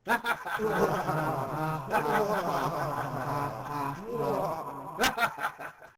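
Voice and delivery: long rising maniacal cackle; unhinged villain. Acted. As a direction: keep cackling like a madman. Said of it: "This is my evil, maniacal laugh".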